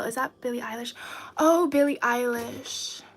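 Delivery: usual speaking voice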